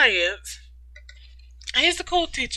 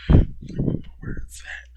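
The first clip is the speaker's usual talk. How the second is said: usual speaking voice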